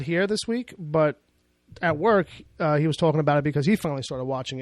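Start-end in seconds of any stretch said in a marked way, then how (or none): none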